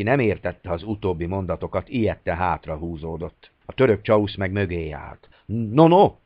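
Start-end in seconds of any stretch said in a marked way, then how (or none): 5.5-6.1 loud, high growled protest. A narrator's reading. Turkish guard warning the captain back, growled loud. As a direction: bark the no's out hard.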